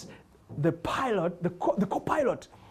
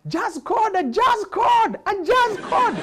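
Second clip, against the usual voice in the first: high, loud call from the crowd; heckler's high shouted request; shouting request; 14 semitones higher, 5 dB louder, slower than usual. Retold or imitated. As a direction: yell it up at the stage, high. Audience member shouting requests.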